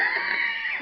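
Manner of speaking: very high shriek of laughter; piercing giggle; high squealing laugh. Real, not acted. Laughing at own quote.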